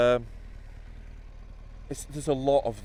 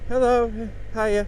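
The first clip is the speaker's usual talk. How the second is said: awkward fake greeting; 8 semitones higher, 3 dB louder, slower than usual. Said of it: "so you look at them, like".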